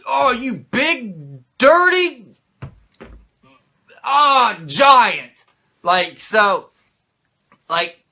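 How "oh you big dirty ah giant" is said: higher, louder sputtering mock scold; hammed-up flustered dad scolding. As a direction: splutter like a dad too mad to swear.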